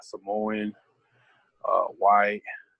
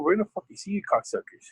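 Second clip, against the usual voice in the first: higher, raised retold taunt; imitating a parting threat; mock threat; 10 semitones higher than usual. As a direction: threaten the rival team, half-acted. Threat to rival team retold.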